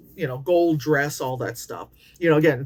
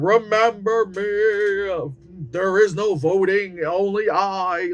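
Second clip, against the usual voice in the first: slow, looming mock-dictator proclamation; much slower than usual. Mocking the school portraits as a slow dictator decree. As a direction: drawl it like a self-crowned tyrant. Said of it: "fucking dictator ass freaking".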